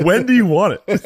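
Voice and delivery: high and laughing, rising at the end; laughing question. Retold or imitated. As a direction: ask it while cracking up. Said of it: "I go".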